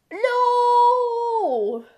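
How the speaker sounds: high, loud far-off cry of NO; shouted no. Real, not acted. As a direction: yell it out in shock. Sudden in-game shock.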